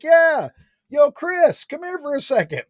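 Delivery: high, loud call to a friend indoors; playful summoning call. Retold or imitated. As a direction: shout for Chris to come out. Calling his friend out.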